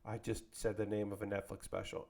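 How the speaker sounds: low, soft imagined apology; hushed, low pretend excuse; mock apology. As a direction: apologize meekly under your breath, acted. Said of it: "And I would go".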